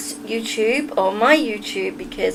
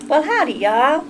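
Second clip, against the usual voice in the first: attempted Southern accent; 3 semitones higher than usual. Shown as a demonstration. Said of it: "I can't do a southern accent".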